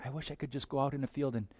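soft dreamy sigh of a wish; mock a romantic daydreaming pray-er; airy whimsical mock prayer; dreamy wistful prayer. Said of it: "Anne of Green Gables prayer".

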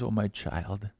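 put-on soft, low, tender reply to a child; God answering the child, soft and patient; gracious and patient. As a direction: gently soothe a frustrated child. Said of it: "he says, graciously and patiently".

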